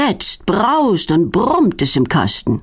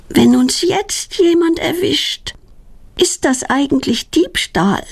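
put-on higher and slow, worried girl; anxious question; 8 semitones higher, slower than usual. A narrator's reading. Hiding from a car, girl anxiously asks about theft. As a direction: ask fearfully, a touch of dread. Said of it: "fragt Claudia ängstlich".

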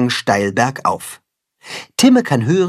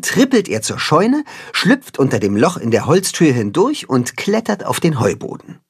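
usual speaking voice

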